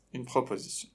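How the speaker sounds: normal talking voice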